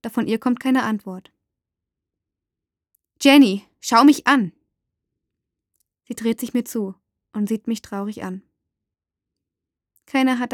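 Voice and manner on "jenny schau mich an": put-on loud, slow appeal to a child; insistent call. A narrator's reading. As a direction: demand her attention, raised voice. Mother demands the girl's attention. (eindringlich und laut)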